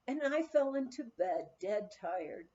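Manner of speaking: ordinary delivery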